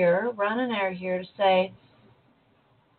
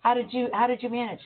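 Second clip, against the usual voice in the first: normal talking voice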